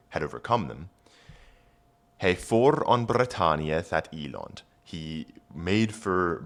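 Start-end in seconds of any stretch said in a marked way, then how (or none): 2.2-4.6 put-on Old English read aloud. Shown as a demonstration. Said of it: "rereads Old English line".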